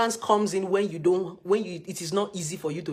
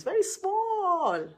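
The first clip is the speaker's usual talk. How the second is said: squeaky high stretched "very small"; mock tiny voice; 13 semitones higher, much slower than usual. To her viewers about marriage, a tiny squeaky voice. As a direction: make it sound tiny, drawn out. Stressing how tiny the things are.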